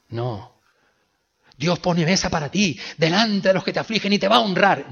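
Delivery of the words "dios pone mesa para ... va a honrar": loud, higher triumphant preacher voice; mocking the usual upbeat reading. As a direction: parody the feel-good sermon. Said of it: "No es eso lo que está diciendo aquí".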